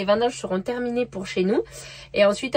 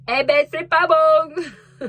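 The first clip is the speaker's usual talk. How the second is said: loud, slow, high-pitched complaint, each word dragged out; mock outraged punchline; 6 semitones higher, 6 dB louder, much slower than usual. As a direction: belt the punchline like an offended customer. Punchline of the cucumber tart joke. (chute lancée fort et lentement, plus aigu)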